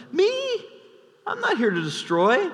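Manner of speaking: lightly high feigned innocence; the same claim, a little calmer; mock innocent. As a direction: repeat the denial, still pretending. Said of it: "And Joab says".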